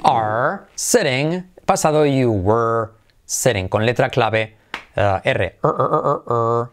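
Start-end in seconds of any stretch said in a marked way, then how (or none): 5.2-6.7 short repeated "er, er, er"; isolated R sound. Shown as a demonstration. Teacher isolating the key R for learners, repeated short sound. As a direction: drill the sound "er" on its own, over and over. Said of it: "T como R".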